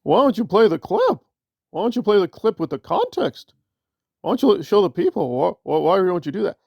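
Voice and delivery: hammed-up indignant pestering; mocking Nora. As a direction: imitate a nagging critic with exaggerated demands. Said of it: "And she's like".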